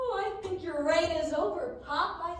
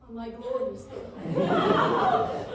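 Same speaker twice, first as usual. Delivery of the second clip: slow laughing gloat; 5 semitones lower, 6 dB louder, much slower than usual. Acted. Son savors imagined throne.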